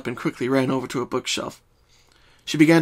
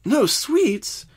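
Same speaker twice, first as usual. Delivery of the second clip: higher, louder shocked question; 12 semitones higher, 3 dB louder than usual. Acted. Twilight hears Pinkie refuse sweets, shocked. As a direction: gasp it in disbelief. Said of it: "Twilight asked in shock".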